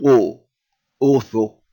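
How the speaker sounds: "O" said as a rounded O, repeated; teacher sounding out "O"; demo O sound. Shown as a demonstration. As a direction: sound out "O" clearly each time. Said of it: "so you will do it O".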